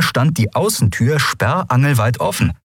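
ordinary delivery, nothing marked

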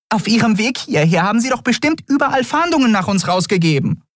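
very high-pitched, fretful little voice; small creature in a high panic; agitated fairy. Acted. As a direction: fret in a tiny squeaky voice.

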